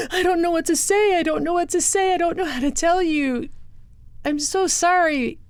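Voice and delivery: high, breaking voice of a sobbing woman; imitating crying. Woman holding the girl, choking out the terrible news. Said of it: "she was crying so hard".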